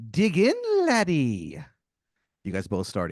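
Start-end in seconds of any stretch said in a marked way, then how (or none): 0.1-1.6 high, loud, slow stage-Irish brogue. Acted. Leprechaun-like stranger greeting the player, hammy Irish brogue. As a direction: ham up a leprechaun-style Irish barfly. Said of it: "He goes".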